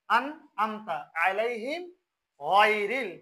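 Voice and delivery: slow আনআমতা আলাইহিম, closed N, then গায়রিল with throaty rounded "ghoy"; correct recitation. Shown as a demonstration. Teacher models the verse, slow correct recitation ending on গায়রিল. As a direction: draw out আলাইহিম, heavy GH in গায়রিল. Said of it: "তাহলে এটা হবে কি?".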